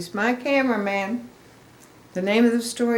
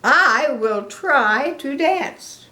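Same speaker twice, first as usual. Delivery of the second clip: slow, louder, higher, earnest promise; determined Gerald; 7 semitones higher, 6 dB louder, much slower than usual. Acted. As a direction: say it slowly as a big resolve. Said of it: "with a funny look in his face".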